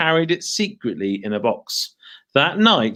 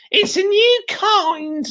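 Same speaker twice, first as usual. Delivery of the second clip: sly crone; 3 dB louder, slower than usual. Acted. Mrs Twit voiced.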